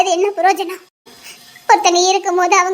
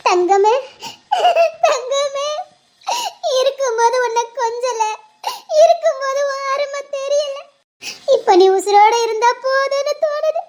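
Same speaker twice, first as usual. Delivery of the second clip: tearful lament; 2 semitones higher than usual. Acted. Mother laments missing daughter.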